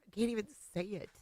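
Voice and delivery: real tears breaking through; choked up. Real, not acted. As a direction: voice catching, fast and soft. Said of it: "I'm not gonna be able to get through this".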